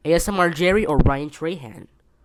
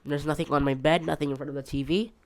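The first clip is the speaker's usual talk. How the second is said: ordinary talk, nothing marked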